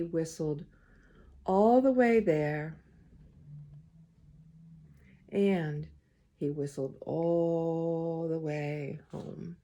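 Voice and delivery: put-on gentle read-aloud to children; story reading. A narrator's reading. Reader to the children, storytime voice. Reads picture book aloud.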